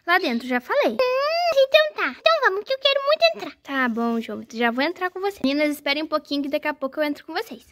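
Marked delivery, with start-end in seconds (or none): 0.9-3.6 put-on child voice. Mother voices son doll.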